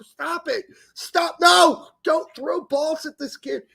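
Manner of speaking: high-pitched mock shouting; imitating alarmed coaches. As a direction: call out like coaches chasing a kid. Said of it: "the coaches were running behind him".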